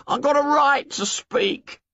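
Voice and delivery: put-on high, aggrieved boy insisting on his turn; hurt Piggy. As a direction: plead to be heard, wounded. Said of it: "said Piggy in a hurt voice".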